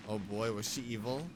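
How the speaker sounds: ordinary talk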